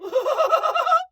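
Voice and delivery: imitating a ridiculous high laugh; imitated ridiculous laugh. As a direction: cackle like the singer. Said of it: "follow it up with that".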